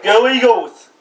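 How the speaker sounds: full-voice stadium cheer; shouted cheer. Pretend. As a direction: belt it out like a fan. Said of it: "Do it".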